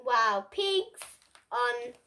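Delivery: ordinary delivery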